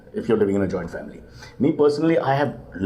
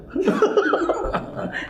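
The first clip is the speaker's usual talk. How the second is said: giggle. Real, not acted. Laughing about Parsi New Year.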